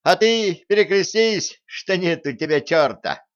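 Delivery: high, loud, impudent taunt; cheerful, cheeky. A narrator's reading. Cheeky rebel dares the officer to cross himself, cheerful taunt. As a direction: pipe up merrily and insolently. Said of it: "Послышался весёлый дерзкий голос".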